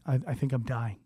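low, hushed and faltering; small, soft voice of dread; weak, stunned confession. Retold or imitated. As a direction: murmur it haltingly, as if frozen onstage. Acts out his onstage panic.

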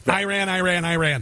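Iran chanted as "eye-RAN"; taunting mispronunciation. Pretend. Host taunting the journalist, Iran repeated as "eye-RAN". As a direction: rattle off Iran as "eye-RAN" to needle him.

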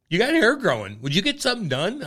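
a hint of a chatty hairdresser. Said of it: "the girl's like".

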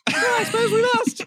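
very high squeaky put-on child voice; child character. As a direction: pipe up as a mock-innocent kid. Child character answering Savile.

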